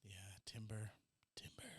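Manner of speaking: very soft, whispery aside; whispered repeat of the word; hushed echo. Real, not acted. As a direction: echo it almost under the breath.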